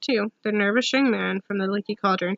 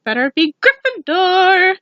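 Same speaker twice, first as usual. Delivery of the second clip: slow, loud, higher proclamation; hat's shout; 8 semitones higher, 6 dB louder, slower than usual. A narrator's reading. Sorting hat announcing the house to the hall, loud and slow. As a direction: boom it out to the whole hall. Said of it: "heard the hat shout the last word".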